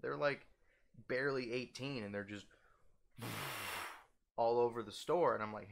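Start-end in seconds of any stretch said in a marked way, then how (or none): 3.2-4.0 long, breathy blow-out; long exhaled whoosh; imitated vape exhale. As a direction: puff out a big vape cloud. Imitates kids exhaling vape clouds.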